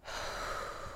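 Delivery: long slow audible exhale; deep breath out. Shown as a demonstration. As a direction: breathe all the way out with the listeners. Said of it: "I'll do it right now with you".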